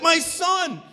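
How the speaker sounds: put-on high, loud cry for his boy; panicked call for son. Father losing his son's hand in the crowd, high panicked shout. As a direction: shout out, losing your child. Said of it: "I was like".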